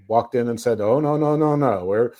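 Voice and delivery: ordinary delivery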